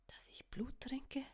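secretive whisper, afraid of being heard. A narrator's reading. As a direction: breathe it out so no one overhears. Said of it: "flüsterte er".

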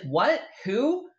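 baffled "what, who" shooting up high; confused friend. Retold or imitated. Friend at the ATM, baffled and rising high. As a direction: play the clueless friend caught off guard.

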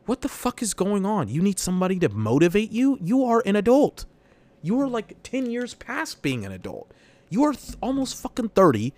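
high-pitched, incredulous scolding; talking to a toddler. Retold or imitated. Exasperated worker to twenty-eight-year-old colleague, as if to a toddler. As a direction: scold a grown man like a small child. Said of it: "fucking speaking to a fucking toddler".

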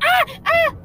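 short high, loud yelps; hyperventilating shriek. Acted. Dragon pointing out panicking Rat, high yelps. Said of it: "your hyperventilating".

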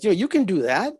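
lightly voiced mom urging him on; encouraging mother. Mother tells her son he can do it, encouraging. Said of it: "She says".

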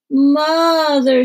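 put-on high, loud bawling child. A narrator's reading. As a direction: bawl it out for mother. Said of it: "she bawled".

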